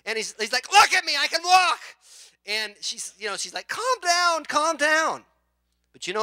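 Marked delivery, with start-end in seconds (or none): 0.7-1.8 high, loud joyful shout of a healed man; overjoyed cry. Retold or imitated. Husband overjoyed at being healed. 3.7-5.2 frantic calm down. Retold or imitated. Wife trying to calm husband.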